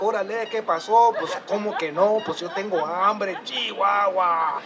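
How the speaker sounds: joking slangy Spanish voice; playful Chicano banter. Acted. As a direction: toss it off like a buddy on the mountain. Playful Spanish banter coming down mountain.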